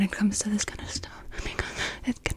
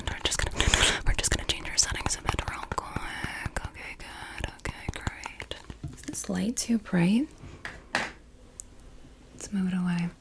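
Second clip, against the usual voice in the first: ASMR whisper. Real, not acted. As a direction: whisper close to the mic.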